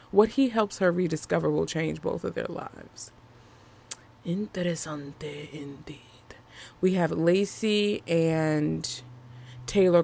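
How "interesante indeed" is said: soft, whispery, musing aside; hushed aside. Real, not acted. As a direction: drop to a whisper, as if intrigued. Playful Spanish aside, near-whispered.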